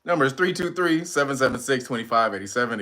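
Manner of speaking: usual speaking voice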